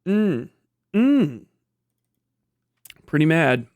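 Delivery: ordinary delivery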